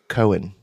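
"Cohen" said as KOH-en, two syllables; "Cohen" for coin, the way they say it in Iowa. Shown as a demonstration. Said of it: "You know what they say in Iowa?".

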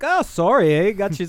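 put-on high, sing-song Canadian accent. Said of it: "all of 'em were like".

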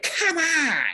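high-pitched mock yell; drawn-out squealed imitation of a yell; retold yell. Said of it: "when he yelled".

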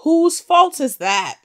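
high, loud, drawn-out rhetorical question; mock-indignant, stretching it out loud and high; exasperated retort. Acted retort blaming the man.

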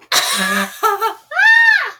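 a loud, high burst of real laughter. Real, not acted. Reader bursts out laughing at herself. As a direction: crack up at her own limp wrist. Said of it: "I didn't even mean to limp wrist".

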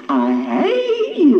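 high, slow, crying cry of I hate you; tearful insult. Retold or imitated. Wife's parting insult to her husband, high tearful wail. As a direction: let the voice break as you hurl it. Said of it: "my last insult was always".

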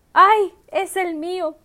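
loud slow shocked cry; shocked realization. Retold or imitated. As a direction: stretch out the shock. Seeing her own car.

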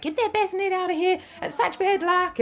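mock scolding old neighbour. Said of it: "this older neighbour come in and she's like".

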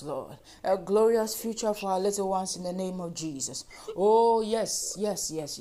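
urgent pleading prayer, building in force; impassioned intercession to the congregation and God; fervent prayer. Real, not acted. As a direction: plead insistently, repeating each petition with growing intensity.

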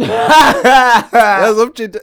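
words broken by laughter; laughing out loud mid-story. Real, not acted. Laughing while retelling.

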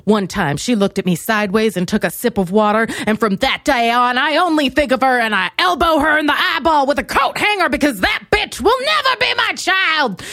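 vindictive diva. Acted. Diva nursing a petty grudge, building vindictive tirade. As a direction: climb steadily into a grand mock tirade. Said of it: "you want your divas to be like".

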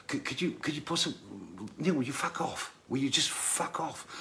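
soft, fast, strained politeness tipping into swearing; quiet, hurried plea that turns to fuck off; polite then swearing. Retold or imitated. Roger quietly tells his drunk roadie to get lost, strained polite. Roger losing patience with Neil.

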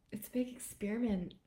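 ordinary talk, nothing marked

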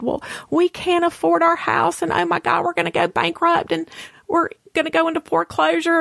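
high, rushed, retold worry; tearful panic. Telling her friend they face bankruptcy, tearful panic. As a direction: imitate a worked-up, high-pitched tearful outburst. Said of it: "And I was crying".

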